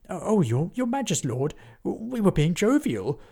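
high, flustered and stammering; nervous pleading. A narrator's reading. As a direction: beg the king, tripping over words. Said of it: "pleaded Hobgob, laughing nervously".

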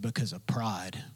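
whispered gentle answer. Retold or imitated. Preacher voicing God's gentle reply in a whisper. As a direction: breathe it softly, like God's quiet voice.

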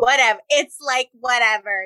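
put-on breezy valley girl whatever.

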